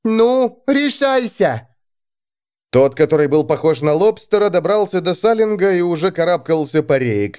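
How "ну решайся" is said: high, slow urging call; urging shout. Acted. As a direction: push her to decide. Sunshine urges her.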